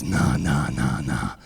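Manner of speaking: low, soft, slow lazy voice; dopey cool singer. Retold or imitated. Comic imitating the Seattle singer teasing the crowd, low and slow. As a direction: drawl it like a stoner rock singer. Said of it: "He goes".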